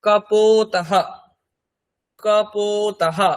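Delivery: কপোতাহা slowly as ka-pooo-ta-ha; slow model pronunciation. Shown as a demonstration. Teacher gives learners the Sanskrit for pigeon, slow কপোতাহা. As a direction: stretch the long o in কপোতাহা, each syllable distinct. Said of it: "তার সংস্কৃত কি হবে?".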